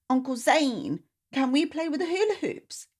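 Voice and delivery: put-on high hopeful little-girl voice; child voice, eager request; child asking. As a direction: beg like a small girl.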